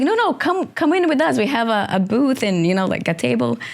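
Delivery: lightly voiced high invitation; imitating the actor. Actor inviting her into the nightclub, pitched high. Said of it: "And he's like".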